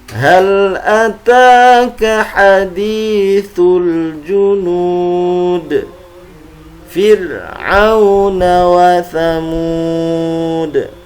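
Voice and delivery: slow, careful Quran recitation; over enunciating. Shown as a demonstration. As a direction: chant each syllable deliberately. Recites verses, each twice.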